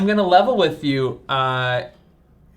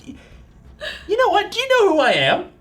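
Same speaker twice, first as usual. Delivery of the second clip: indignant boast. Acted. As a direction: puff up like an offended hero.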